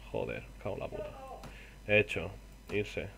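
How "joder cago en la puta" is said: maldiciendo entre dientes. Real, not acted. Se queda sin dinero.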